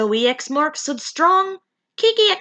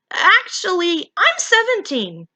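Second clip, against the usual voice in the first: higher, hesitant admission; nervously; 8 semitones higher, 3 dB louder than usual. Acted. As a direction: confess it nervously, pitched up. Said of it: "Kiki said nervously".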